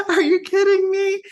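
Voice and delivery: retold astonishment breaking into laughter; shocked laughing disbelief. Wife stunned her husband agrees, laughing disbelief.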